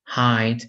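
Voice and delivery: "Hide" with a long I, like "eye"; long vowel. Shown as a demonstration. Teacher giving learners an example, long I in "Hide".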